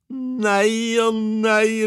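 slow, drawn-out delighted cry; wife exclaiming over the trade. A narrator's reading. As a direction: stretch it out with joy. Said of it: "ropte kona".

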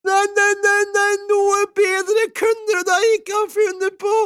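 very high, loud gushing; wife thrilled at the rooster; ecstatic cry. A narrator's reading. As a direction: shriek with delight. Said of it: "ropte kona".

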